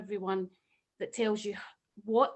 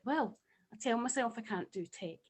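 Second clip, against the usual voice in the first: normal talking voice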